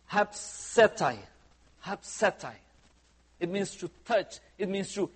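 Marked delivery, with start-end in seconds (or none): 0.1-2.5 'hapsetai' said slowly, syllable by syllable: hap-se-tai; new Greek word. Shown as a demonstration. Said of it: "I'm gonna teach you a new word".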